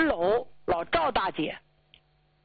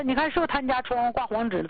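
fast, anxious, stress on 不, falling end; hurried question; 3 semitones higher, faster than usual. Retold or imitated. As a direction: rattle it off needing him to confirm. Asks husband to confirm. (又快又急地问老伴儿，重读“不”)

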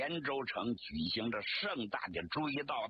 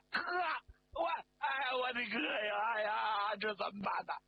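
anguished wail; 9 semitones higher than usual. Acted. Man seeing his brother swept away, wailing. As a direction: wail helplessly for a lost brother, pitched high.

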